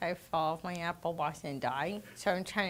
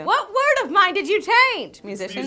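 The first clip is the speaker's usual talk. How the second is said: high, very loud demand; shouted, high-pitched accusation; mock indignant outburst; 11 semitones higher, 13 dB louder than usual. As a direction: explode in comic outrage. Acted indignant reaction in a bit.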